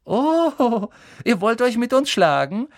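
high, loud scornful jeer; mocking sneer. Malfoy jeers at Harry and Ron wanting a fight, mocking. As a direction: laugh at their challenge. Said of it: "höhnte Malfoy".

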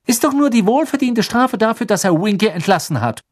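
put-on high, heated girl's voice; indignant retort. Hermine insists the dismissal was deserved, indignant. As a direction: say it hotly, pitched well up.

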